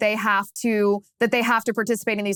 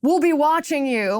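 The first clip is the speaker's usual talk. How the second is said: mock heckle; 6 semitones higher than usual. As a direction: heckle him like a parade watcher. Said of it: "heckles him and says".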